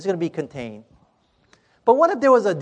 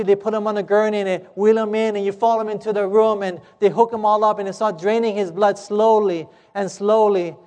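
ordinary delivery

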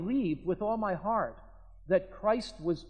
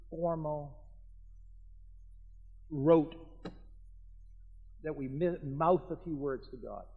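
softer, measured preaching with long pauses; solemn sermon close; 3 semitones lower, 6 dB quieter than usual. Real, not acted. Preacher closing on prayer and lasting peace, solemn and hushed. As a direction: let each phrase settle quietly before the next.

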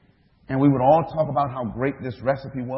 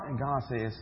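ordinary talk, nothing marked

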